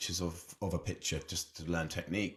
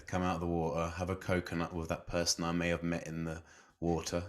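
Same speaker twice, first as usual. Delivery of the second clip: ordinary delivery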